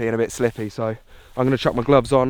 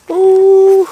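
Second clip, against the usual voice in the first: high loud exhausted ooh; loud whoop; 13 dB louder than usual. Real, not acted. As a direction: let out a big strained ooh. Exhausted at the summit push.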